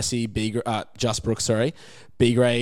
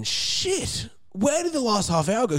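squeaky, much higher startled voice; shocked disbelief; 11 semitones higher, slower than usual. Retold or imitated. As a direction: gasp it out in sudden panic. Quoting his panicked thought on stage.

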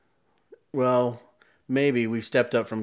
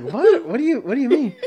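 baffled clerk; 13 semitones higher, 7 dB louder than usual. Retold or imitated. Acts out confused store guy.

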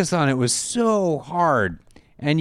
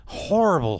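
normal talking voice

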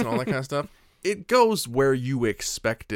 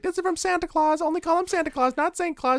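put-on much higher, childlike announcement; kids' flyer pitch; 5 dB louder than usual. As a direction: chirp it door to door like a kid.

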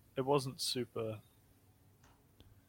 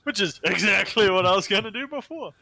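mock innocent; 9 semitones higher, 11 dB louder than usual. Loud mock-innocent claim.

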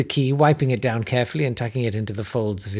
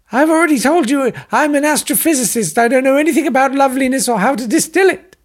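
much higher, louder desperate scientist; shouting captive; 14 semitones higher, 7 dB louder than usual. Acted. Captive astrophysicist yells at his captors, shrill and desperate. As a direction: shout through the door, fed up. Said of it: "I could hear shouting".

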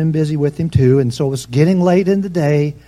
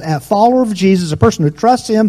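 louder, higher preaching at full force; fiery preaching; 4 semitones higher, 5 dB louder than usual. Acted. Preacher rousing the church about fear. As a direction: drive the point home to the congregation.

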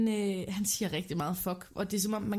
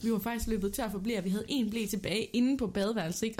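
ordinary talk, nothing marked

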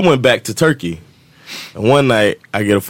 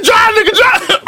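very high, louder frantic yell; acted panicked shriek; 4 dB louder than usual. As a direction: screech the getaway order in mock panic. Acts out panicked getaway order.

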